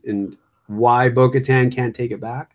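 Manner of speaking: usual speaking voice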